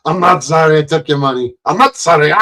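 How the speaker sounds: cheeky not-sorry, stress on not; Pee Wee Herman impression. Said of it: "my impression of Pee Wee Herman".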